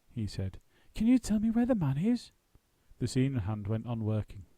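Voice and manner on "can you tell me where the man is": Paddington voice. Acted. Paddington voice, high pitched.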